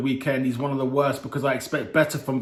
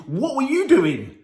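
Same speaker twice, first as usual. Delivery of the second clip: incredulous; 9 semitones higher, slower than usual. Real, not acted. Outraged rhetorical question at driver.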